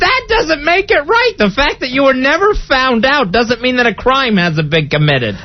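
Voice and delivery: high-pitched, protesting outrage; indignant, voice jumping high; indignant scolding. Real, not acted. Host scolds prankster over food tampering.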